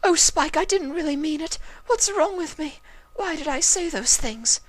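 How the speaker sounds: high, anguished voice; tearful self-reproach. A narrator's reading. Rarity running after Spike, crying out. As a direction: scold yourself through tears. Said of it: "she chastised herself".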